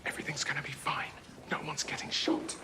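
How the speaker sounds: soft, fast, hushed comfort; hushed reassurance to his panicking friend; reassuring whisper. Real, not acted. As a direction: whisper it to calm him. Whispered reassurance amid danger.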